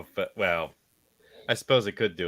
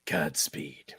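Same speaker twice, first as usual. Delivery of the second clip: whisper; 6 semitones lower, 7 dB quieter than usual. Real, not acted. Farewell whispered.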